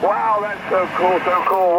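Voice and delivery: ordinary delivery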